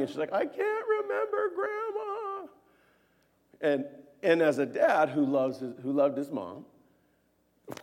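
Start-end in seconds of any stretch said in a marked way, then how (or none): 0.3-2.5 very high, drawn-out mock wail; slow, squeaky pretend sobbing voice; imitated crying child. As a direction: imitate a child wailing through tears. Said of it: "She's just crying".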